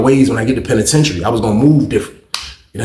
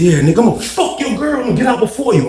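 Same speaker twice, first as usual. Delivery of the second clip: high, heated goading; worked-up mock threat, pitched high; retold spiteful taunt; 8 semitones higher than usual. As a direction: act out taunting him mid-quarrel.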